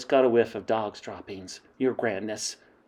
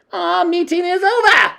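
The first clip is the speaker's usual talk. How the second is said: announcing witch queen; 9 dB louder than usual. Acted. Grand High Witch ending the meeting, high and loud. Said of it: "announced the Grand High Witch".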